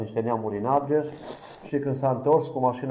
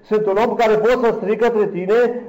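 high, loud plea building line by line; fervent prayer outcry; 8 semitones higher, 5 dB louder than usual. Retold or imitated. Preacher reliving his thankful prayer to God, fervent outcry. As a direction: cry out to God, climbing to a louder peak.

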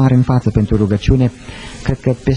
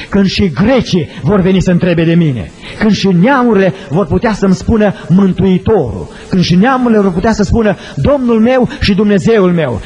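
preaching at full force; 8 semitones higher than usual. Acted. Preacher to his congregation, about Jesus' cry of joy, preaching at full force. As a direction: lift the whole church with it.

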